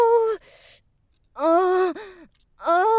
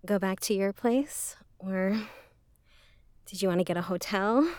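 spent afterglow; 7 semitones lower, 5 dB quieter than usual. Acted. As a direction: lower and softer, a sated purr. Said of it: "I can't trust my legs yet".

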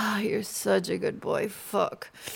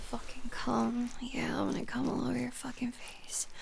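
quick, urgent whisper; fast whisper; 7 dB quieter than usual. Acted. Nearing climax, she whispers urgently and fast. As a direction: rush it out under the breath, fast.

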